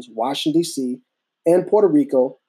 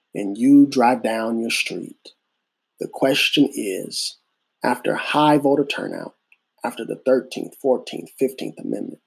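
preaching; 3 semitones lower than usual. Acted. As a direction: lay out each point like a sermon. Slow rhetorical preaching build.

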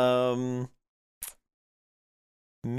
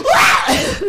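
high squeaky shriek; creature shriek; 12 dB louder than usual. Acted. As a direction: shriek like a tiny imp. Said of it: "and he goes".

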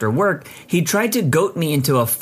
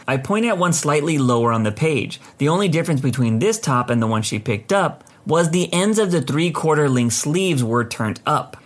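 ordinary delivery, nothing marked

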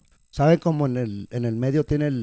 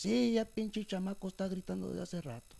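higher-pitched retold complaint; imitated cleaning lady; 6 semitones higher, 9 dB quieter than usual. Cleaning lady complaining about the screaming child, lightly imitated. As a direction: quote her grumble in a raised voice. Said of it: "así me dijo la señora".

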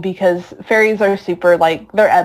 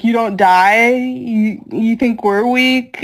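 higher, slower sneer at the end; mocking taunt; 5 semitones higher, slower than usual. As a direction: rub his face in it. Guts taunting the dying demon.

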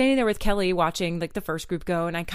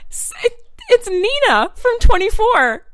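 excited realization; 10 semitones higher, 5 dB louder than usual. Retold or imitated. Realizing she was right.

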